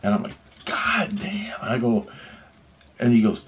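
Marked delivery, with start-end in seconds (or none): 0.6-1.6 hushed "God damn" of awe; retold astonishment under the breath; whispered amazement. Patient hearing about the surgeon's shifts, whispered awe.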